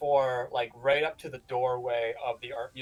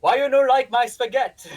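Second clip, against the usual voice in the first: much higher, louder hammed-up Italian mother; mock Italian mama; 10 semitones higher, 11 dB louder than usual. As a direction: scold like an offended Italian nonna, over the top. Joins mock Italian mama bit.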